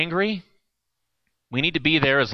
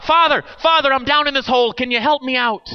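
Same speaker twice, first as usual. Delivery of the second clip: higher, louder plea from a hole; shouting up; 10 semitones higher, 5 dB louder than usual. Acted. Man in a hole calls up to the priest, shouting. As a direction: call up desperately to the priest. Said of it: "The guy shouts up".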